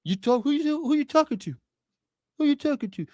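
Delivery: high, frowning New York tough-guy voice; Robert De Niro impression. Streamer doing his De Niro for the chat, mock tough-guy. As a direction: scowl through a mock De Niro "You talking to me?". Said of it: "that's my Robert De Niro".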